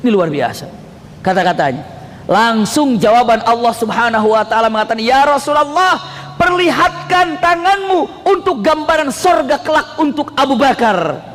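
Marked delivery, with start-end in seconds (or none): 5.0-11.2 high, slow declamation of a divine command; ringing, drawn-out announcement; solemn proclamation. Acted. Preacher acts God's command.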